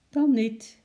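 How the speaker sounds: put-on a little sulky girl; miffed. A narrator's reading. Girl reacting to her pet staying home, miffed. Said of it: "zei Lotje".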